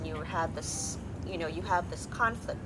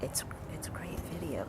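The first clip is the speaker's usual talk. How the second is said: soft whispered aside; a quick hushed compliment; 5 semitones lower, 5 dB quieter than usual. Real, not acted. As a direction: whisper so the mic won't catch it. Aside while mic is adjusted.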